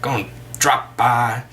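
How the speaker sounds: hammed-up attempt at an American accent; bad American accent. Shown as a demonstration. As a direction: put on a clumsy American accent. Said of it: "they'd say it in a more".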